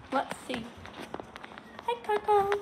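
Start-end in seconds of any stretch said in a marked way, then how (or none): 1.9-2.5 high-pitched dog greeting. Real, not acted.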